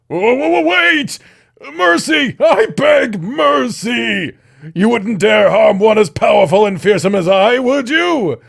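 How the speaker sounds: high, loud frantic pleading; panicked begging. Acted. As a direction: beg for your life, loud and high. Snake begs for mercy.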